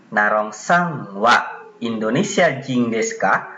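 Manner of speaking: slowed, phrase by phrase for learners; slow model reading. Shown as a demonstration. Teacher repeating the second pattern's example for students, slowly. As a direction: say it slowly, separating "Narong sang wa" from the rest.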